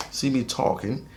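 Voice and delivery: usual speaking voice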